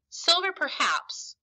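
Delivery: slow, high, huffy grudging concession; in a huff. Acted. Squire huffily conceding about Silver. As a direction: drag it out, sulky and put out. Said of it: "still in a huff".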